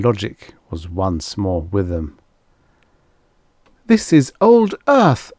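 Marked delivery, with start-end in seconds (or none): 3.9-5.3 high, loud proclamation; triumphant cry. A narrator's reading. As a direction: cry it out as a discovery. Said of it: "cried Finn".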